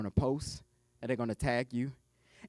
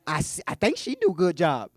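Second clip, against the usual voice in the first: country talk; 3 semitones higher, 7 dB louder than usual. Pretend. Joking to y'all about a business coach, country talk. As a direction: lay on the country talk, loud. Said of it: "that's that country talk".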